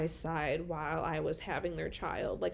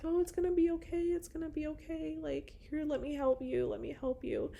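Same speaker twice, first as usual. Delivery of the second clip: higher, soft soothing nurse; reassuring nurse; 8 semitones higher, 4 dB quieter than usual. Retold or imitated. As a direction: comfort a crying patient gently. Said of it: "she's like".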